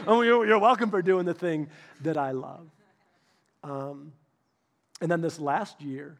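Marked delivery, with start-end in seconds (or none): 0.1-2.7 loud, ironic self-mocking you're welcome; sheepish husband mocking himself; mock magnanimous. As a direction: poke fun at your own smugness. Self-mocking his own reply.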